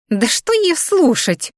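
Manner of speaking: high, slow squeaky whine. A narrator's reading. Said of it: "песклявым голосом блондинка".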